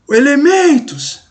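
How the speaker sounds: high, drawn-out call of ELEMENTOS, stretched on MEN; calling out a name. Shown as a demonstration. Teacher pretends to call a friend out of the house, calling out. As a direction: call ELEMENTOS as if to someone indoors. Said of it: "você vai gritar".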